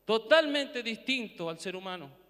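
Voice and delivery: ordinary talk, nothing marked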